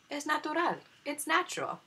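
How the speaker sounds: put-on high, light girlish voice; a young girl soothing her nurse; sweet high voice. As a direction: say it gently, up high. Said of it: "Adelita told her".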